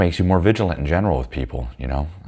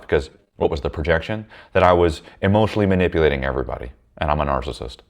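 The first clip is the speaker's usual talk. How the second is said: usual speaking voice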